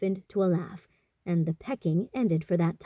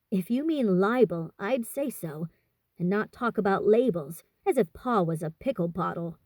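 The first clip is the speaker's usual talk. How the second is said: ordinary delivery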